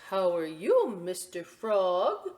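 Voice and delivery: loud, slow, sing-song greeting; cheery store owner. Acted. Store owner greeting the boy in frog gear, cheery. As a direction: greet the child brightly and slowly.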